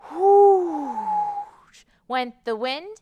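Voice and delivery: slow, drawn-out whoosh; stretched whoosh. Acted. Librarian blowing the wind for the children.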